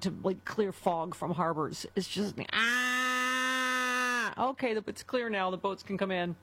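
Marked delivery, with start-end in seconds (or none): 1.9-4.5 long, high, drawn-out foghorn blare; foghorn imitation. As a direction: honk it like a ship's horn. Likening her voice to foghorns.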